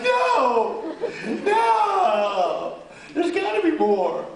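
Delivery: high, loud mock wail; hammed-up protest. Actor retelling his plea to director, hammed-up wailing. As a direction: cry out in exaggerated dismay.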